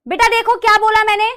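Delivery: normal talking voice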